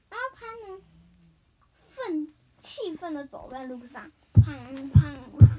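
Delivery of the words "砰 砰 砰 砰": loud imitated stomping bangs; angry footsteps done with the mouth; booming bangs. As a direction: boom out each bang like heavy stomps.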